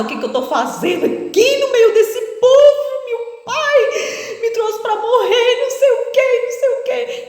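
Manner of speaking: high, wailing crying voice; crying lament. Retold or imitated. Woman climbing down the truck, crying in despair. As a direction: wail through tears. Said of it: "chorando, chorando, desesperada".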